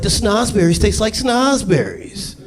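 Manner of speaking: put-on high, slow little girl voice; drawn-out wide-eyed girl. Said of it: "Little girl's like".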